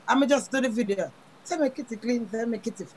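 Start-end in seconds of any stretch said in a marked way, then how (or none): 1.4-2.9 Jamaican vibe. Pretend. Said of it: "in that Jamaican vibe".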